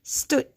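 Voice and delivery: "stood" as a long "sss", then "tood"; sounded out. Shown as a demonstration. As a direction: draw out the S of stood, then pause. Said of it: "only hear one vowel sound".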